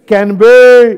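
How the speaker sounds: loud fill-in-the-blank cue to a class; loud prompt. Real, not acted. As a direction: call it out loud and high, drawn out. Prompting class to finish the sentence. (ऊँची आवाज़ में खींचकर पूछना)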